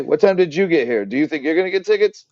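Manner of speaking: excited questioning. Retold or imitated. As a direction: rattle off the questions eagerly. Repeat of line queries.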